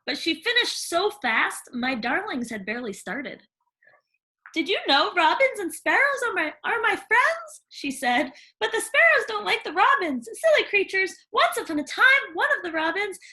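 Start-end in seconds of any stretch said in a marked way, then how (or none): 4.5-7.6 put-on high, bright chatterbox girl; gushing young girl, rising as she goes; eager Cindy telling about animals. Cindy voiced in story. 8.6-13.2 put-on high, bright chatterbox girl; gushing young girl, rising as she goes; eager Cindy telling about animals. Cindy voiced in story.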